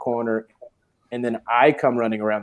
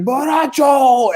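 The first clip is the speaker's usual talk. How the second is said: drawn-out high shout of Borracho; 12 semitones higher, 4 dB louder, much slower than usual. Retold or imitated. Cart driver calling to Sean, drawn-out high shout. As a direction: yell it out to a friend across the road. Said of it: "the guy was just like".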